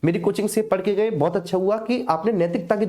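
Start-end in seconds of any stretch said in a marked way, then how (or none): none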